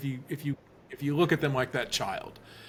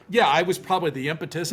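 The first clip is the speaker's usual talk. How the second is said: ordinary delivery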